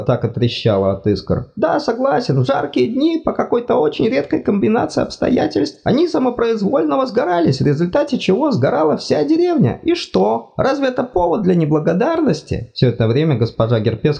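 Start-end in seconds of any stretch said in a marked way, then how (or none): 1.6-12.7 high fussy god voice, apologetic; flustered deity, much higher pitched; embarrassed god. Acted. God complains.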